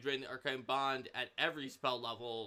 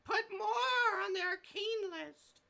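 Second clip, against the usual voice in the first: much higher, slow whiny demand; necromancer voice; 3 dB louder, slower than usual. Retold or imitated. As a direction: wheedle like a greedy necromancer. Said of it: "the necromancer wizard says".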